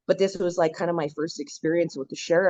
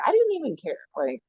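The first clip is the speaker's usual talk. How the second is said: dismissive past self; 5 semitones higher than usual. Retold or imitated. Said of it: "I would have been like".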